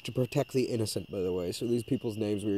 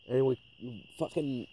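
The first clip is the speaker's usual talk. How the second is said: normal talking voice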